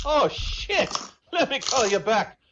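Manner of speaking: high, loud Irish yelp of alarm; a startled Irishman backing off fast; panicked Irish accent. Acted. Paddy hearing the army size, alarmed Irish accent. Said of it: "Irish and Jamaican".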